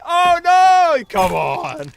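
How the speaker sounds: loud, high cry of dismay; dismayed yell. Real, not acted. Player shouts at the game as his egg drops, real loud yell.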